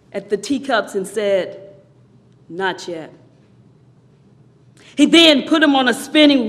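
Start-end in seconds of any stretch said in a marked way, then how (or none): 2.5-3.1 low, very soft, calm answer; quiet refusal. Acted. Master answers the yelling teacup, quiet refusal. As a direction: drop right down to a hushed reply.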